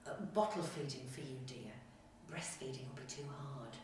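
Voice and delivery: a hint of a dismissive nurse; patronising nurse. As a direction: play a mildly condescending nurse quietly. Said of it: "she said".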